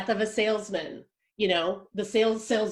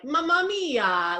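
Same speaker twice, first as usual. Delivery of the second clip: mock Italian; 6 semitones higher, slower than usual. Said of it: "I can't do an accent".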